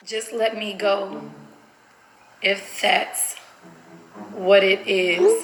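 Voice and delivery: slow, broken-up dictation. Shown as a demonstration. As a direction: say it in slow chunks as you type. Sounds out words while typing them.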